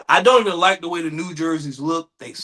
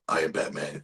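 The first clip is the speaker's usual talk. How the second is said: very low, softer superhero voice; Batman; 8 dB quieter than usual. Acted. Testing a serious voice after clearing throat, deep parody. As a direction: drop way down into a mock hero voice.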